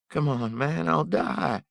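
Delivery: high, loud desperate begging; a ragged thief pleading at gunpoint. Acted. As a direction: beg for your life in a strained high voice. Thief pleading for his life.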